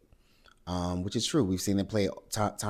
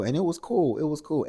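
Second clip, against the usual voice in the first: high, loud, overly chipper it-was-cool; mock breezy insistence; 8 semitones higher, 4 dB louder than usual. Pinky tells the table the ride was fine, high and loud. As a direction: sell it as fine with a put-on bright lift.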